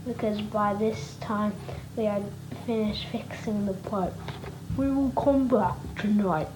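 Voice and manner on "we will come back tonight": usual speaking voice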